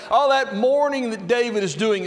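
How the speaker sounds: high, loud, fast gushing; mock gushing praise. Mocking what nobody would say about unwashed mourning, high and loud. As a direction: gush over it as if it were lovely. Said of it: "No way would we ever say".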